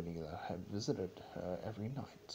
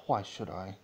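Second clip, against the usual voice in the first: sharp, louder demand; 6 dB louder than usual. Acted. Celestia to Luna, a sharp, louder demand. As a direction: throw the question back at her. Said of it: "demanded Celestia".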